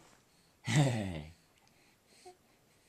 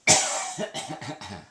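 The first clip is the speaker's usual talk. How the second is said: a playful put-on cough; fake cough for baby; 3 semitones lower, 5 dB louder than usual. Shown as a demonstration. Dad fakes a cough to amuse his laughing baby, playful cough. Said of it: "Daddy's gonna cough one more time".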